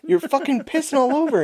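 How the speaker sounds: high mock yell at a drunk buddy; yelling at the buddy peeing on the table; retold yell. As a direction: shout it up high, scolding.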